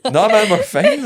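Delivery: high, loud, drawn-out reply through laughter; mock protesting reassurance. Passengers reassure driver who asks, mock protest. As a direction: insist it comically, slow and high.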